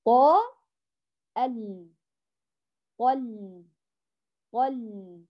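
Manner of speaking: طل with a full ط and a thin ل; heavy ṭā, light lām. Shown as a demonstration. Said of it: "فنفخم الطاء ونرقق اللام".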